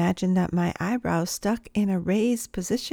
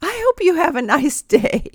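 higher, louder call after a departing car; 8 semitones higher, 6 dB louder than usual. Retold or imitated. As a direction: call after her as she drives away. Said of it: "called out after me".

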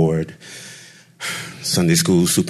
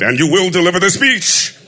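booming father; 13 semitones higher, 5 dB louder than usual. Retold or imitated. Father booms at his son that he will deliver the speech. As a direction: thunder it like a stern pulpit father.

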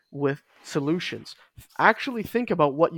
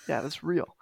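ordinary delivery

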